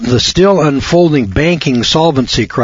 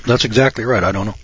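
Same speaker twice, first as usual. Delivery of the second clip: ordinary delivery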